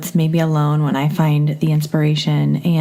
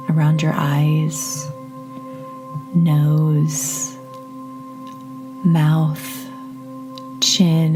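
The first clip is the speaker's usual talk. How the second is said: guided meditation. Acted. Leads a meditation.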